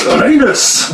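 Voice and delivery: a quick, deliberately bad Scottish "Reynes"; terrible Scottish accent. Shown as a demonstration. Repeating the listener's name as a joke, in bad Scottish accent. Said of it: "really terrible Scottish accent".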